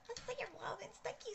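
high, soft sing-song repeat of see. Real, not acted. Thanking viewers for likes.

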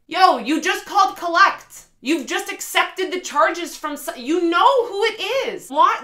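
loud, high, incredulous outburst; frustrated. Real, not acted. Reviewer venting at the character Ben, loud and frustrated. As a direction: vent at the character, fed up. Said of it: "I was just so frustrated".

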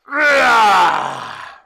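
loud, high mock roar; playful tiger roar for children. A narrator's reading. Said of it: "roared the tiger".

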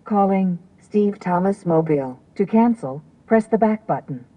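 synthetic car voice prompt; voice assistant prompt. Real, not acted. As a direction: read it like an automated car system. Car system answers the command.

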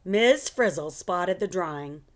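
plain reading voice

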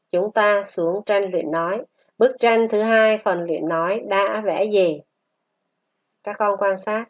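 ordinary talk

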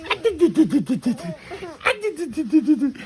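high playful tickle chant; high playful chant while tickling; tickling sing-song. Pretend.

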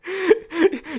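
high, helpless laugh; real laughter. Real, not acted. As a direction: break up laughing. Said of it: "without actually laughing".

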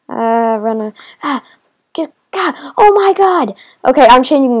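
high, loud real panic; panicked yell. Real, not acted. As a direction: shout it out as things go wrong. Panicking while mobs attack.

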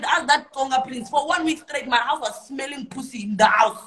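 complaining about the smelly couch, shouting; outraged shout. Real, not acted. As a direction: yell it out in disbelief.

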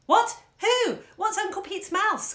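put-on high, loud, eager children's cries; clamouring children. Children clamouring to hear about Uncle Pete's mouse, excited high cries. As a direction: pile in with excited questions. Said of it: "clamoured the children".

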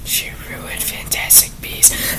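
slow, very faint whisper; whispered grievance. Pretend. Reviewer grumbling about the author, whispered. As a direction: whisper it as a secret accusation.

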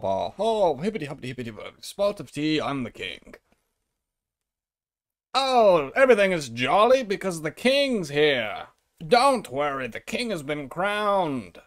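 silly, sing-song British babble; mock British royalist. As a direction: play a daft British monarchist with swooping pitch. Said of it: "that guy that I just impersonated".

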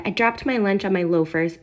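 ordinary delivery, nothing marked

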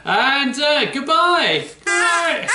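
the puppet drags out its goodbye. Acted.

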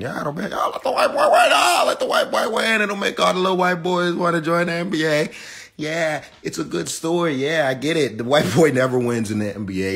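shrill-pitched sarcastic impression; mock sarcastic voice. Mocking voice of NBA fans.

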